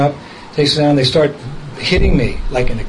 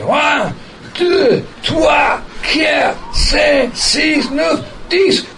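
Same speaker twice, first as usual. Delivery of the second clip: high shouted count as they swing him; 12 semitones higher, much slower than usual. Retold or imitated. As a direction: yell the count, mostly in French, like guards heaving.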